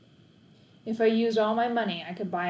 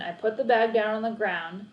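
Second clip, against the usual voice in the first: ordinary delivery, nothing marked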